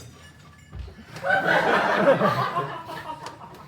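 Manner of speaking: helpless giggling burst; loud giggling fit; laughing fit. Real, not acted. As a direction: break into laughter mid-read. Giggling fit mid-lecture.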